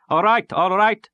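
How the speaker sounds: high, loud, slow cheerful call; calling out cheerfully. A narrator's reading. Tall sailor amusing the crowd, calling out high and loud. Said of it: "calling out cheerfully".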